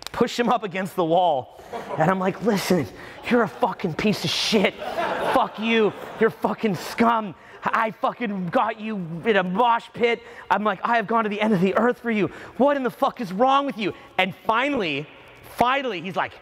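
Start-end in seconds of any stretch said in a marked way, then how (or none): 2.4-10.2 slow, raised mock tirade; angry telling-off. Acted angry confrontation against the wall. 10.8-13.9 high, acted exasperation; exasperated rant.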